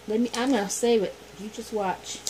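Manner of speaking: ordinary delivery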